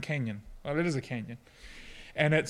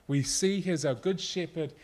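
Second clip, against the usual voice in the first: ordinary talk, nothing marked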